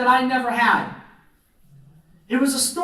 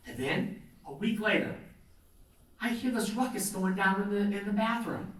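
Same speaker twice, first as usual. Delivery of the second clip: ordinary talk, nothing marked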